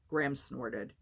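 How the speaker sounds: ordinary delivery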